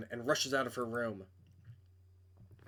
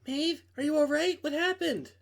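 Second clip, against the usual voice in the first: Camila, raised and a little louder, concerned; worried girl voice; 12 semitones higher, 5 dB louder than usual. Acted. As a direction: call out to her friend in alarm. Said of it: "I can't do girl voices".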